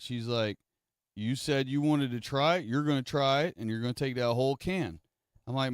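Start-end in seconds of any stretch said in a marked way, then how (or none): 1.2-5.0 raised, louder mom laying down the law; stern mom. Retold or imitated. Mom punishing son with dip, raised stern insistence. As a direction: insist he finish the whole can.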